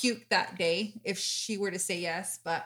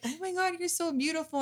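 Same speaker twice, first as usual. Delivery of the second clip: gushing compliment; 8 semitones higher than usual. Retold or imitated. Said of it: "she was like".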